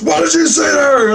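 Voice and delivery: high, acted accusing demand; high-pitched mock scolding; boss's confrontation. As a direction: demand an answer, imitating an upset boss. Boss confronts him, retold.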